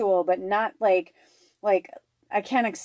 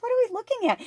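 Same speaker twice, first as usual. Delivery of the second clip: high-pitched repeat; much faster than usual. Retold or imitated. Retelling to viewers, repeating the question high and amused. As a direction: squeak it out, laughing at yourself.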